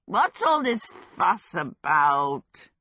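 very slow, drawn-out demand in a character voice; put-on voice, slow and demanding. A narrator's reading. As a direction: demand it slowly, stretching the words out. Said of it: "he demanded".

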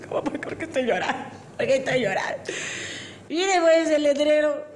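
hammed-up crying, lamenting the state of the world; tearful drunk. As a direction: wail like a sobbing drunk. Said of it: "empieza a llorar".

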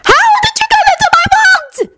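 very high, screeching demand; furious scream. A narrator's reading. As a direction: shriek the accusation at a captive. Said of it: "they heard Bellatrix scream".

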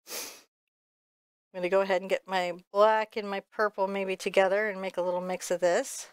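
usual speaking voice

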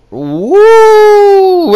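high loud wrestler woo yell; Flair woo. Acted. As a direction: shout it like the Nature Boy.

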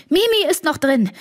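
höher und lauter, ein leicht gespielter Schrei; nachgeahmter Schrei. Retold or imitated. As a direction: ruf es panisch nach. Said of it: "Susi hat geschrien". (Kalle gibt den Schrei seiner Tochter gemildert wieder)

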